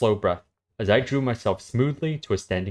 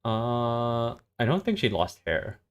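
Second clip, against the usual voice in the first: steady story reading, level and even; story narration; 8 semitones higher, 3 dB louder than usual. Acted. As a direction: read the scene out to a friend. S0 reads a story aloud.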